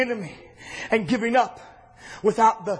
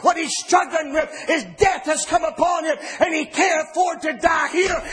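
driving, raised preaching cadence; fiery preaching; 4 semitones higher than usual. Acted. Preacher declaring Jesus' destiny to the church, fiery cadence.

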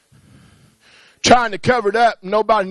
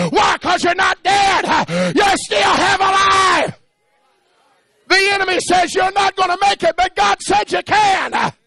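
shouted, higher sermon cadence, every line pushed up; preaching at full force; 10 semitones higher than usual. Acted. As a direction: preach it out to the whole church at full voice. Preacher rallying congregation to pray.

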